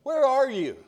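high-pitched searching call, not shouted; lifted voice, falling away at the end; God calling out. Acted. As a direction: call out to someone out of sight. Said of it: "they hear God call out to them".

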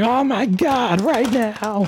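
high squealing excitement; excited squeal. Retold or imitated. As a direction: squeal it out like a thrilled fan. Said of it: "Monster Party crew going".

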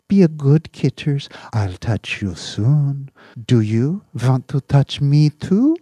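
put-on high, loud baby talk to a pet; doting cat talk. Owner doting on his cat on screen. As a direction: dote on the cat.

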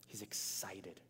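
soft breathy whisper. Real, not acted. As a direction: lean in and whisper it. Sermon point whispered.

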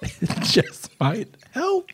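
laughing. Acted. Giggling through a silly pitch.

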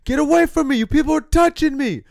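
high, loud mock shout; mock yell. Mike Perry yelling at the crowd, high mock shout. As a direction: yell it like a deranged fighter.

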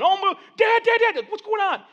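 high, loud mock-panicked children; acted backseat panic; kids yelling. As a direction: imitate frantic kids shouting for Dad. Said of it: "They start, like, yelling".

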